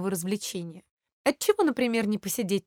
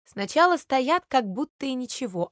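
plain reading voice

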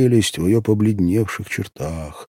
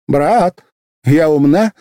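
put-on higher, louder girlish appeal to her brother; girl asking brother; 9 semitones higher, 8 dB louder, slower than usual. A narrator's reading. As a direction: call out to her brother, eager. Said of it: "спросила она Гагина".

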